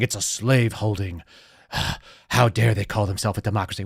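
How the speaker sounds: low, soft sarcastic parody; mock pundit. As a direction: sigh and mimic the host's outrage.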